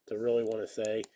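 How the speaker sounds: ordinary talk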